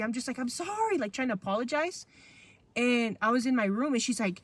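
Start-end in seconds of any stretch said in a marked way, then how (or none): 0.4-1.0 high, pleading mock sob; retold tearful apology. Child saying sorry to an angry mom, high retold tearful plea. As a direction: imitate a crying kid apologizing. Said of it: "crying like crazy".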